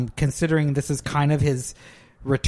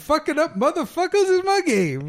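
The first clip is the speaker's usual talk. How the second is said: high, brash rhyming boast, much higher; Dolomite; 11 semitones higher than usual. Acted. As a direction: jive hustler bravado, pitched way up. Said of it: "answers with own Dolomite bit".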